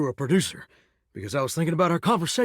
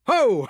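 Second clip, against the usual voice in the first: hearty laugh; 8 semitones higher than usual. Acted. Shower laughing at the visitor's joke, a hearty burst.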